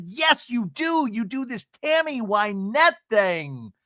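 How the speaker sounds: hammed-up scolding castmate; mock scolding. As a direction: scold her hard. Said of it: "And Emily's like".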